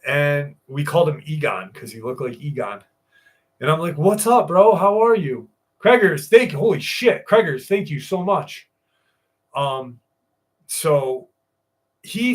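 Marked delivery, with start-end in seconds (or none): 4.0-5.5 higher, louder hey-dude welcome; excited greeting. Retold or imitated. Running into Gabe at Lowe's after years, excited greeting. 5.8-8.7 higher, louder gushing gratitude; overjoyed thanks. Retold or imitated. Gabe thanking Craig when they meet at Lowe's, overjoyed. Gabe's grateful reply, retold.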